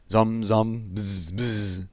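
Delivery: hummed zum and buzzing bzz noises; buzzing bass. Acted. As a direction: imitate a double bass buzzing.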